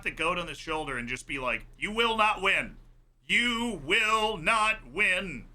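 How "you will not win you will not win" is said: drawn-out mock yell, higher and louder. Defender taunting Messi, drawn-out mock yell. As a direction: yell it slowly like a taunt at the player. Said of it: "That's what he was yelling".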